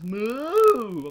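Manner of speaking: loud, high mock moo; the bull in the road mooing at us. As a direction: moo at them like a stubborn bull. Imitating the bull.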